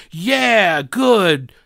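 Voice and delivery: mock cheer with a fake laugh; hammed-up gleeful mock laugh; mock gloating. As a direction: play a smug gloater, ha ha. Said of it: "they'll be like".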